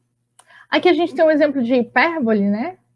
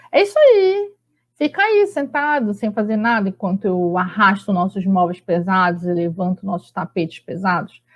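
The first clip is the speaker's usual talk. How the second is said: ironic intonation; 3 semitones lower than usual. Shown as a demonstration. Teacher showing how irony sounds in a wife's complaint, ironic. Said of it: "o que que vai marcar que é uma ironia? A entonação".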